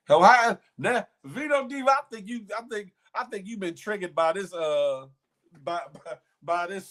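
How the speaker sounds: high, loud incredulous outburst; playful shocked exclamation. Real, not acted. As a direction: go up high in disbelief at the comment. Mock outrage at commenter.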